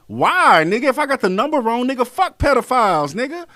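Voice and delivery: he's fed up and lets it fly; heated rant. Real, not acted. Ranting at commenters nitpicking numbers, angry and loud.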